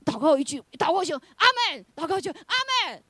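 mock revival prayer call and response; lively prayer meeting. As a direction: build the prayer-and-amen rhythm up high. Preacher mimics prayer meeting.

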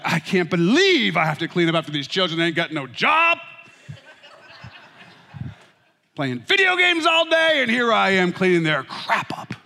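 playful self-righteous rant; mock indignation. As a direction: rant like a martyred father. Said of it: "It's coming out of me".

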